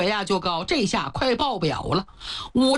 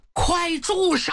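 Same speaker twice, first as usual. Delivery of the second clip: slow, higher commanding cry; shouting stop; 6 semitones higher, slower than usual. Acted. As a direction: call out sharply to stop him. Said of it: "庆都大喊一声". (拖长声大喊住手)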